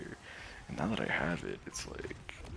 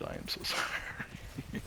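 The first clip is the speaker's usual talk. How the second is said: slow, drawn-out sorry breaking into laughter; laughing apology; 11 semitones lower, slower than usual. Real, not acted. Apologising to disappointed players, laughing through it.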